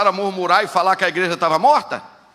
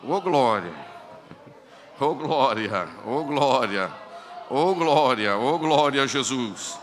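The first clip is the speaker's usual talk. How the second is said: soft, low repeated praise; quiet worship after the climax; worshipful murmur; 5 semitones lower, 4 dB quieter, much slower than usual. Real, not acted. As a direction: savour each glory. Worshipful exclamations.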